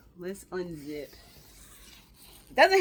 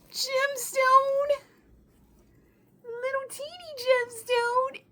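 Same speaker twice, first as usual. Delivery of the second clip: excited squeal; 12 semitones higher, 4 dB louder, slower than usual. Real, not acted. Discovering gemstones on dress.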